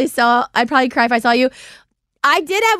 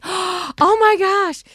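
touched gasp; 7 semitones higher, much slower than usual. Retold or imitated.

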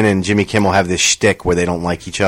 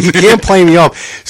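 mock indignant shout; 9 semitones higher, 4 dB louder than usual. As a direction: refuse to be played off by the orchestra. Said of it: "He's like".

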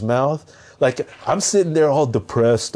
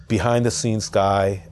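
ordinary delivery

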